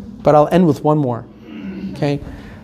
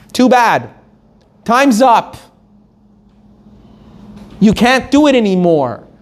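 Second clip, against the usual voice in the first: merciless verdict; 5 semitones higher than usual. Acted. Acted verdict to the condemned.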